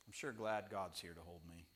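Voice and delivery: low, soft aside to himself; acted soft, low relief; quiet private thought. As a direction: drop right down into a hushed murmur.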